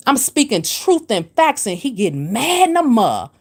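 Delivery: ordinary talk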